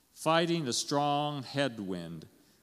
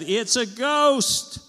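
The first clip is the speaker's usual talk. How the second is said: high, loud, drawn-out cry of fright; frightened mock scream, stretched slow; terrified scream; 12 semitones higher, 9 dB louder, much slower than usual. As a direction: cry out as if you've seen a ghost. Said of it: "They were so frightened, they screamed".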